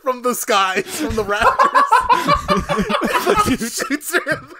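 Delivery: high, loud laughing through the words; can barely talk for laughing; laughing hard. Real, not acted. Telling a friend the best scene, cracking up.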